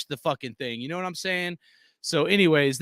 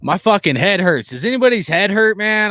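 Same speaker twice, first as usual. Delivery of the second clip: loud and hyped; 11 dB louder than usual. Real, not acted. Still hyped after the game.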